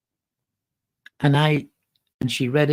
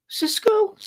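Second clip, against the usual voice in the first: high, surprised shout of a name; surprised cry; 4 dB louder than usual. Retold or imitated. Seeing his friend from Kansas, a high surprised call of his name. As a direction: recognize a friend out of nowhere.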